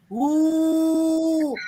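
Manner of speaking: high, far-off mock ghost ooh; ghost moan answering. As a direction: moan back like a hidden ghost. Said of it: "him hear the person say".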